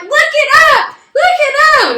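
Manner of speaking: very high, shrieked retold demand; freaked-out urgency. Freaking-out driver ordering the passenger to check the story. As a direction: shriek the order in mock panic. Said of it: "So I'm freaking out".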